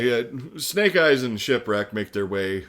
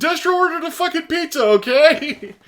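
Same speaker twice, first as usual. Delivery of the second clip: very high, loud, squeaky protest; squeaky pizza guy; 11 dB louder than usual. Retold or imitated. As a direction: squeal it like a frantic delivery boy.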